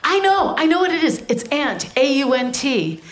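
panicked man seizing on an answer. Acted. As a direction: blurt it out in a mock panic. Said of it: "he's panicked and he's panicked".

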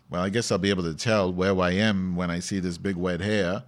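ordinary talk, nothing marked

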